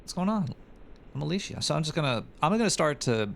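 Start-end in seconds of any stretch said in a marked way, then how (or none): 0.0-1.6 mock reply. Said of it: "And I'm like".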